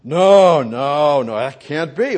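loud, rising cries of no; a preacher's loud refusal; emphatic protest. Acted. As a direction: shout the denial out.

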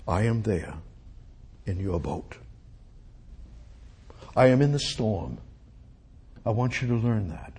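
hushed, low voice of Jesus; calm, intimate divine assurance; quiet reassurance. Acted. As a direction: speak softly, with long pauses between phrases. Speaks as Jesus reassuring disciples.

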